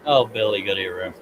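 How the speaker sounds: usual speaking voice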